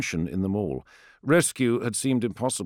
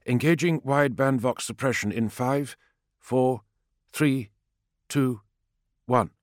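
higher clipped countdown voice; pilot countdown; 6 semitones higher than usual. A narrator's reading. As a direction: count down over the radio. Pilot counts down over vox.

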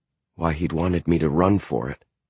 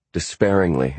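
usual speaking voice

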